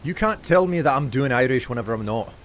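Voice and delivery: Scottish accent. Shown as a demonstration. Streamer disputing that it's Irish, in an indignant Scottish accent. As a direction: protest in a put-on Scots accent. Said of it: "doing in a Scottish accent".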